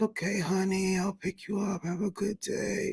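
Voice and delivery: imitating a gentle mom. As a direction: coo it like a mother to her child. Mom voiced to daughter.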